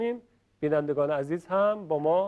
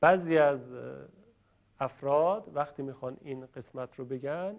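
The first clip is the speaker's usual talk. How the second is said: ordinary talk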